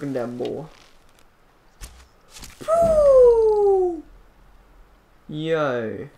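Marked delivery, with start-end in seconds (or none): 2.7-4.0 high, loud, drawn-out whoop; long whoop. Real, not acted. Player hollering in the game, long high whoop. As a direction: let out one long whoop.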